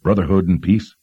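talking while chewing; mouth full. A narrator's reading. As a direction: speak around a bite of bread. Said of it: "He picked up his tava bread for a bite".

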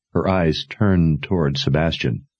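usual speaking voice

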